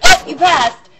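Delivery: chirpy official announcing success; cheerful surprise. Retold or imitated. Driving examiner telling her she passed, mock cheerful. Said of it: "and they went".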